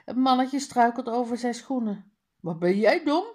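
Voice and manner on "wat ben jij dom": dog calling out. Acted. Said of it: "roept de hond".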